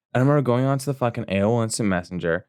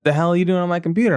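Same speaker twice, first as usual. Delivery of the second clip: higher, fast mock dad demand; angry dad; 8 semitones higher, much faster than usual. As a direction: storm in and demand it.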